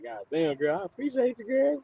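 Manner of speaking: high, choked-up thanks, half joking; hammed-up weepy gratitude rising high; mock tearful. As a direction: thank her as if tearing up. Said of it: "that shit brought tears to my".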